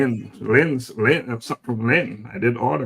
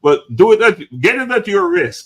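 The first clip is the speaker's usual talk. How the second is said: playful warning; 8 semitones higher, 6 dB louder than usual. Pretend. Playful warning, much louder.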